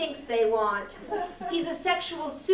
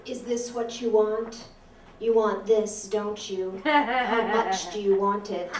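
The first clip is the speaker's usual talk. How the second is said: tempting devilish whisper; 4 semitones lower than usual. Retold or imitated. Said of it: "His voice has gotten lower, he's talking dirty".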